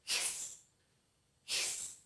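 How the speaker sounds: teacher shows the snake's sound, a soft hiss. Shown as a demonstration.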